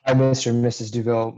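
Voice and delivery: normal talking voice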